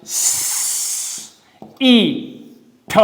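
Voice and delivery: letter sounds said one by one; short sounds demo. Shown as a demonstration. Parents' phonics teacher sounds out short sounds. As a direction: sound out each letter separately. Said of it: "We gave a few example like".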